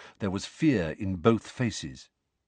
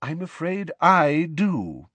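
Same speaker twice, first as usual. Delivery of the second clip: slow, higher-pitched apology; apologetic; 7 semitones higher, slower than usual. A narrator's reading. Dumbledore contradicts Fudge, apologetically. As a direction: break it slowly, with polite regret. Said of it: "said Dumbledore apologetically".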